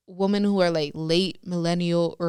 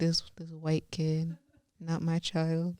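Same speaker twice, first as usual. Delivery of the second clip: whispered, slow imitation of dad; mock whisper; 2 semitones lower, 10 dB quieter, slower than usual. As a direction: hush it like a joking aside. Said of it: "he'd be like".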